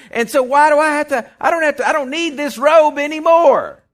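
acted excitement, pitch climbing by the end; excited declaration. Bartimaeus throwing off his beggar's robe, excited declaration. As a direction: exult as the beggar who found the Master.

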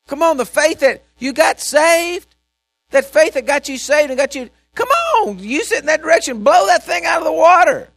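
loud, rising exhortation; preaching at full force. Acted. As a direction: rouse the congregation. Exhorting the congregation.

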